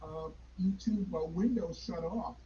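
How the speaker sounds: faint delayed echo through a speaker; distant repeat from another device; echo from second device. Real, not acted. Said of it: "I'm still hearing an echo".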